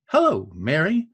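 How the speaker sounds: "hello, Mary" as hel-LO MA-ry, two stresses meeting; iamb then trochee. Shown as a demonstration. Showing an iamb followed by a trochee, stresses back to back. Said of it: "an iamb followed by a trochee".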